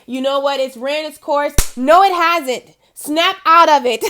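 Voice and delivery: rising to a loud, emphatic call; a firm wake-up call to the viewer; loud urging. Real, not acted. Urging viewer to stop doubting.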